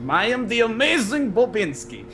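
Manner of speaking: high theatrical Russian accent; boastful showman in a Russian accent. Shown as a demonstration. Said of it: "a Russian accent like that".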